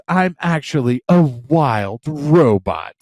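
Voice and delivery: slow, mechanical robot voice that is hammed up; mock robot voice. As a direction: deliver it like a stilted robot. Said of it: "She goes".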